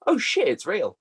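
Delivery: sudden high-pitched realisation; surprised. Retold or imitated. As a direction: blurt it out in shock. Retold surprised reaction.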